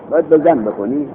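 usual speaking voice

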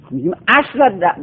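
ordinary delivery

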